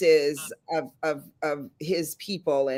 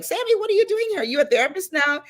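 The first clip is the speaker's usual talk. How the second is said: high, delighted surprise; running into an old friend, high delighted surprise; surprised; 13 semitones higher, faster than usual. Retold or imitated. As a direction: squeal his name in happy shock. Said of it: "And I was like".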